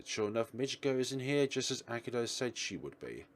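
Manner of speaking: ordinary delivery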